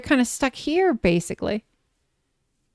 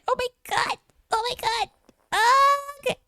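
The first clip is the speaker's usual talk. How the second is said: hushed high gush; 12 semitones higher, 5 dB quieter than usual. Real, not acted. Hushed gushing.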